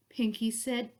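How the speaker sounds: ordinary delivery, nothing marked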